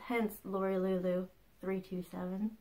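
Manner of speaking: ordinary talk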